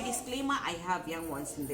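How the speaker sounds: ordinary talk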